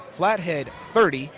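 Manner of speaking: ordinary talk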